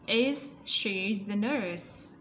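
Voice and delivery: slow, with the Z in "Is" sounded out; slow model question. Shown as a demonstration. Teacher modelling the question for pupils to copy, slow. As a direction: read "Is she the nurse?" very slowly. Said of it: "Z ending sound".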